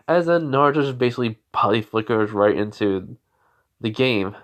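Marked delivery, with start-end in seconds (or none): none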